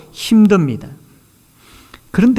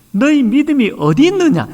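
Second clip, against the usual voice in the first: voicing Jesus' question higher and louder; Jesus asking, raised; 9 semitones higher, 4 dB louder than usual. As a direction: ask it as Jesus would, lifting the voice. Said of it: "예수님께서 우리에게 질문하셨던 것처럼".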